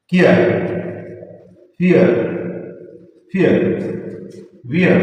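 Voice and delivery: कियर, फियर, वियर as isolated examples, final r dropped; each word alone with a long pause between, R left silent. Shown as a demonstration. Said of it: "जिनमें आर silent है".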